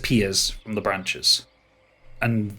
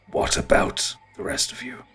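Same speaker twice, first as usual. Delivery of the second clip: ancient ent; 4 dB quieter than usual. Acted.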